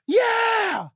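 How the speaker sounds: high, drawn-out mock crowd yell; crowd's cheer. Crowd answers the tightrope walker, shouting eagerly. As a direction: roar back like an eager crowd. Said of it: "And they go".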